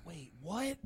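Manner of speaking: a stunned, voiceless whisper; whispered shock. Acted. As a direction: gasp the question out in a whisper. Shocked reply to the punchline.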